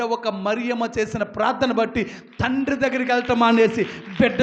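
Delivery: loud, driving revival preacher; preaching at full force. Real, not acted. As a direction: preach it out to the whole congregation. (ఉద్వేగంగా, గట్టిగా ప్రసంగం)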